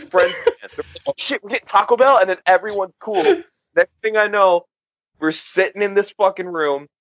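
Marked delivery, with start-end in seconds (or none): 1.1-2.2 quick, higher-pitched excited question; retold delighted surprise. Fighters offered fast food mid-brawl, retold delighted surprise. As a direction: imitate brawlers instantly won over.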